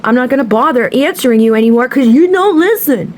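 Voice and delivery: rising high, fed-up telling-off; exasperated scolding. Retold or imitated. Reader telling off a client who won't listen, exasperated. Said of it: "And it's like".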